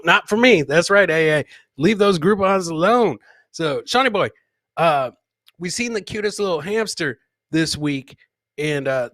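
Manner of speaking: ordinary delivery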